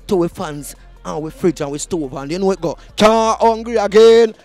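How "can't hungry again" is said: usual speaking voice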